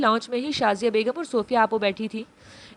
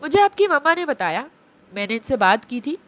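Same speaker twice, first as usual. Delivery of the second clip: usual speaking voice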